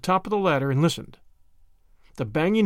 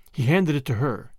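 plain reading voice

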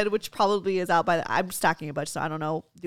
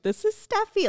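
put-on high, babyish "This is Steffi"; mock the mom's baby-talk introduction; cutesy intro; 12 semitones higher than usual. Daughter mocking mom's babyish introduction to a stranger, high and cute. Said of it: "You can't just be like".

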